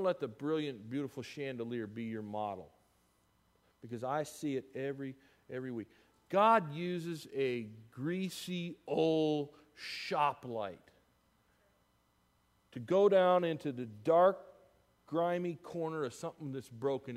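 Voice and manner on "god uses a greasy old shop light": very slow, weighted words; slow emphatic line. Real, not acted. Preacher landing the sermon's image of the shop light, very slow. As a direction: lean hard on each word.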